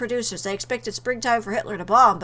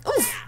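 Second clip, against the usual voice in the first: high, short "oof"; impact grunt; 11 semitones higher, 3 dB quieter than usual. Acted. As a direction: grunt as you land hard. Landing after the jump.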